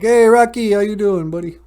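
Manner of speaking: rowdy buddies calling out. Retold or imitated. Street buddies greet Rocky.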